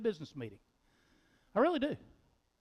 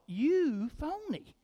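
scornful self-jab; 4 semitones higher, 4 dB quieter, slower than usual. Retold or imitated. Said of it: "I thought".

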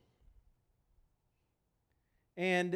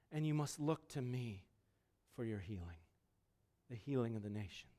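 low, soft voice sinking lower; hushed solemn promise; 9 semitones lower, 8 dB quieter than usual. Acted. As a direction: let the promise settle quietly over the listeners. Solemn close of Jesus's voiced promise.

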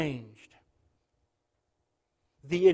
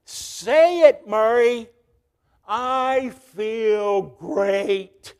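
coaxing demand; 6 dB louder, much slower than usual. Retold or imitated. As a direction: urge him to say it. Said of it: "Lasorda tried again".

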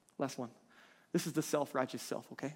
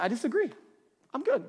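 high-pitched, breezy mock refusal; playfully cocky objection, pitched up; mock defiant; 9 semitones higher, 4 dB louder than usual. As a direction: brush it off, sending up the self-satisfied. Mimicking people defying God.

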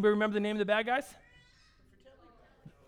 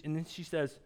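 ordinary delivery

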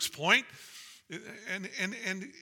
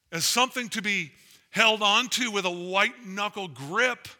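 louder, climbing, fervent sermon delivery; full-force preaching; 5 semitones higher, 5 dB louder than usual. Acted. Pastor urging the church that gospel opens wallets, full-force preaching. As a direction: preach it out with rising fire.

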